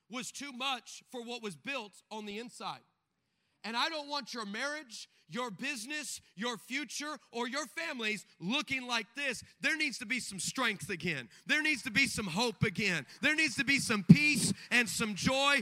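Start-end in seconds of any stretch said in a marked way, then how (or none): 3.6-15.6 louder and louder preaching; rising, almost sung preaching; preaching crescendo. Acted. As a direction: build the call to full force. Preaching builds to climax.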